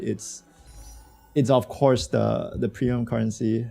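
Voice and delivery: ordinary talk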